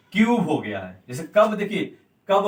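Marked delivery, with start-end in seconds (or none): 0.1-0.5 क्यूब said as "kyoob" with a clear y glide; careful pronunciation demo. Shown as a demonstration. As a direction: stress the long u in क्यूब. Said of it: "ये". (क्यूब का साफ़ उच्चारण, "क्यूब")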